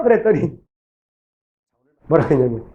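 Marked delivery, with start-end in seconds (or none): none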